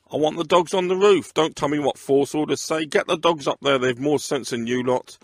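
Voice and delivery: angry command. Acted. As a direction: snap orders at useless men. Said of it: "he barked".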